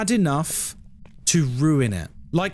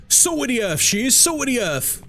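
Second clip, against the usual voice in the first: mock fond praise; 3 semitones higher than usual. Streamer praising Sandra in a hammed-up local voice. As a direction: gush over her like a proud neighbour.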